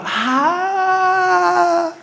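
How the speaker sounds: long, high, thinned-out cry; imitated scream. Preacher shows how Abraham burst out crying, high scream. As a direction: let out a sustained high wail. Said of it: "cómo se soltó gritando Abraham".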